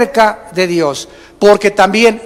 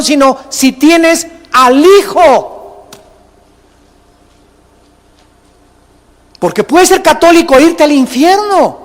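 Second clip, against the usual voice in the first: higher, forceful preacher's cry; preaching at full force; 5 semitones higher than usual. Acted. Pastor warning the church about religion, high and forceful. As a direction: build to a ringing climax.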